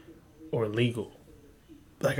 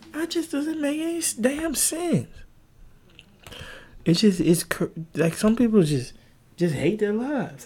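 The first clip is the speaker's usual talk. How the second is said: exasperated; 11 semitones higher, 3 dB louder than usual. Real, not acted. Exasperated comment.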